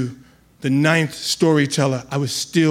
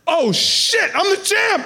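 much higher, laughing triumphant cry; loud elated yell. Retold or imitated. New champ realizes he won, high laughing shout. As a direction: shout it as the win hits you. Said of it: "To which I said loudly".

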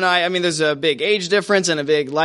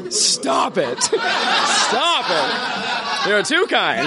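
mock-modest, laughing through it; milking the applause; 11 semitones higher, much slower than usual. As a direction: pretend to wave off cheers.

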